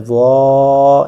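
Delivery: وا held long and loud, tongue at root of front teeth; heavy ظا. Shown as a demonstration. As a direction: stretch وا heavy from the tooth roots. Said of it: "اس type سے".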